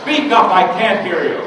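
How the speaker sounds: high, very loud teacher's order; commanding shout. Acted. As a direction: blast the mumbling pupil. Teacher orders boy to speak up.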